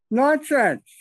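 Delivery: a sharp, high, loud New Jersey "Nonsense"; New Jersey accent. Retold or imitated. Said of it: "in his New Jersey accent".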